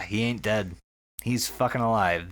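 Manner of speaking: usual speaking voice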